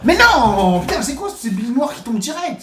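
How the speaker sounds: high outburst, loud at the start; indignant disbelief. Real, not acted. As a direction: explode on the first words, then protest high.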